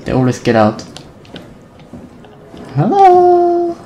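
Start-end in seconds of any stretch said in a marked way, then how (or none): 2.8-3.8 very high squeaky put-on voice; a silly tiny squeak; squeaky hello. Player mocking herself, squeaky high.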